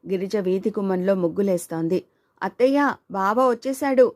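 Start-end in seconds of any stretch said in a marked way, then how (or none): 2.4-4.1 higher, louder call across the house; shouting happily. A narrator's reading. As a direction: call out to auntie that he's here. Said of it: "గట్టిగా అరుస్తూ".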